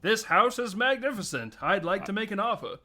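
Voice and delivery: high, loud mock-grand voice; mock-grand declaration. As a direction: proclaim it like a pompous house buyer. Acted line from the film.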